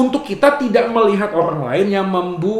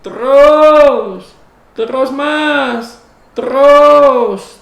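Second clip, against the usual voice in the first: higher, louder, drawn-out parking attendant call; 5 semitones higher, 7 dB louder, much slower than usual. Retold or imitated. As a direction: wave the car back, singing out terus. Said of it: "Jawa itu jadi tukang parkir".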